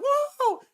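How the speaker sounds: very high, drawn-out "woo"; imitated celebratory whoop. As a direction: whoop like a fan out a car window. Imitating revellers from car windows.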